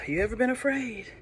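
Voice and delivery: plain reading voice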